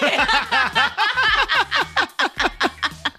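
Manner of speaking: high burst of laughter. Real, not acted. Laughs at the pickup line.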